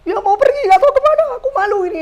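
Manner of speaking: high, hammed-up daughterly fluster; distressed protest. Ashamed daughter tells mother she is leaving, distressed protest. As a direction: act out panicked embarrassment, pitch jumping right up.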